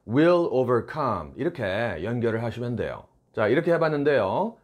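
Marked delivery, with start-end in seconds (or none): none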